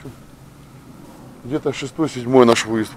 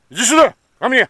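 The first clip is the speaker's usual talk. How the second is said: high, loud call to a dog; 13 semitones higher, 7 dB louder, faster than usual. Real, not acted. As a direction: call her in from afar. Calling dogs in the field.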